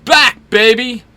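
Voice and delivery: loud, high, swaggering announcement; crowing shout of a returning show-off; cocky yell. A narrator's reading. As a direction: yell your big entrance to the whole house. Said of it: "walks through the door and yells".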